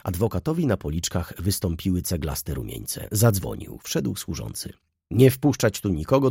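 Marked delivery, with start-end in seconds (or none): 5.1-6.3 higher and louder command to the servant; firm order. Acted. Lawyer orders the servant to let nobody in, louder and higher. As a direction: bark the order so no one comes in.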